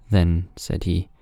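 ordinary delivery

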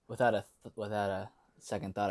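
ordinary talk

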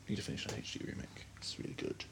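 breathy whisper aside. Real, not acted. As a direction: whisper it to yourself. Unvoiced aside.

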